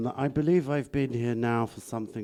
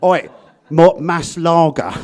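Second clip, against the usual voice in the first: loud, slow Londoner's Spanish; North London-accented Spanish; 5 semitones higher, 8 dB louder than usual. Retold or imitated. Guest imitates friends ordering a drink, loud London-accented Spanish. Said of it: "Kind of way that some of my friends speak Spanish".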